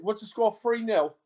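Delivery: put-on English accent. Shown as a demonstration. American guest showing off his impression to the hosts, in a put-on English accent. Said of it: "my English accent might be a little better".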